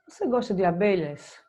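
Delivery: ordinary talk, nothing marked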